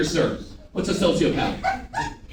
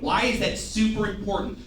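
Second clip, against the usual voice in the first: ordinary talk, nothing marked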